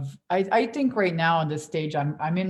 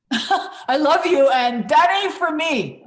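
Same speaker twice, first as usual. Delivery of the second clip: loud, slow, laughing declaration; laughing loudly; 10 semitones higher, 9 dB louder, slower than usual. Retold or imitated. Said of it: "I was like".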